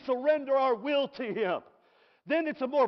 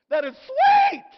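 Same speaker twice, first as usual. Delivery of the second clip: high, loud joyful exclamation; ecstatic cry; 12 dB louder, slower than usual. Real, not acted. Preacher to congregation, a high, loud ecstatic cry. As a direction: leap way up and belt it out.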